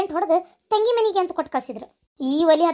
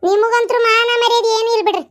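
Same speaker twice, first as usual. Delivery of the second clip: loud, raised angry voice; heated family quarrel, voice raised; angry scolding; 4 semitones higher, 6 dB louder than usual. Acted. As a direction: scold them indignantly at high volume.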